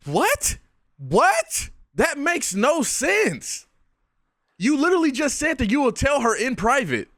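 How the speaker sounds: high-pitched baffled outburst; incredulous, exasperated and high; utter disbelief. Real, not acted. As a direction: shoot the pitch up in total bafflement. Baffled reaction to clip.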